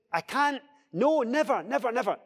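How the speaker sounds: high-pitched, determined protest; emphatic refusal. Retold or imitated. Peter won't be calmed down, doubling down emphatically. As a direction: pile up the refusals, pitched well up. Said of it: "he doubles down".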